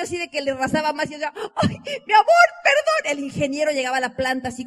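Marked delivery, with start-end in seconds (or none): 1.6-3.1 high apologetic cry. Retold or imitated. Apologizing after botched haircut.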